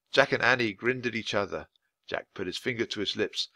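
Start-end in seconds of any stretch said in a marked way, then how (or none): none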